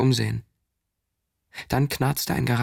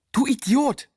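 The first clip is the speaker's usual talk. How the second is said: high, loud furious scream; a boy yelling at his friend in shock; screamed insult; 12 semitones higher, 4 dB louder, slower than usual. A narrator's reading. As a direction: scream it at him in rage. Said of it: "schrie ich".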